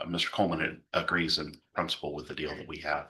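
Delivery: ordinary delivery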